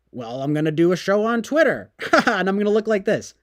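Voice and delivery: a hint of a gloating anchor; mock Tucker. Voicing Tucker sarcastically.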